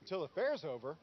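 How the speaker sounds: disbelieving question, held in check; restrained indignant question; incredulous echo. Acted. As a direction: repeat it back in disbelief. First incredulous repeat of the words.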